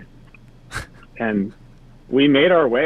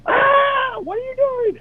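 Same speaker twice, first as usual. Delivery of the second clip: a sudden high shout from far below; startled shout; slower than usual. Retold or imitated. Woman below yells at men peeing on her. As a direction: yell up at the men, high and shocked. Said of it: "and she's like".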